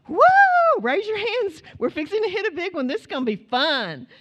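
high, loud mock thrill ride cheer; fake excited whoop. Mother on a stormy plane pretending to her kids it's fun, high mock cheer. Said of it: "I'm just lying".

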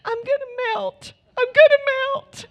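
very high, rising to a loud wail; panicked toddler voice; imitating a scared little daughter; terrified little girl.

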